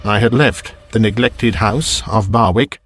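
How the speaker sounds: plain reading voice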